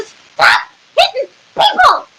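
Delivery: high, emphatic cry to stop; ordering guards. Acted. Drow tells guards to stop, exasperated high cry. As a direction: cry out each word in protest. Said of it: "I'm gonna tell the guards to".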